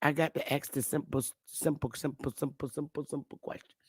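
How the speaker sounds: fading mutter. Real, not acted. Streamer to chat, dwindling to a whisper. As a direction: let it trail away to a whisper. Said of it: "I'm gonna ask some simple questions".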